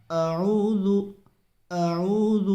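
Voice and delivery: اعوذ with hamza first, then a clear throaty ain; clear ain sound. Shown as a demonstration. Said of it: "عین کی آواز نکالنی ہے".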